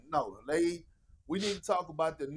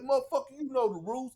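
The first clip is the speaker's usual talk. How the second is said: mock scolding; 6 semitones higher, faster than usual. KG scolding the doctor.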